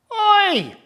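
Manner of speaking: high, loud call of "Oi"; merchant calling out. Acted. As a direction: shout it up high to get attention. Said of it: "he called".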